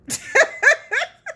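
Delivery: high, loud burst of laughter. Real, not acted. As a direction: break into laughter at the memory. Laughs at her own joke.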